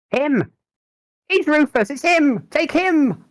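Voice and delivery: high-pitched, loud accusing scream; wretched woman screaming. Acted. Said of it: "screams some, uh, some wretched woman".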